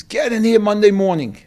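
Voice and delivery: imitating a yelling buyer, high and loud; angry yelling. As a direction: yell at the supplier, mock-furious. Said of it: "he starts screaming at me".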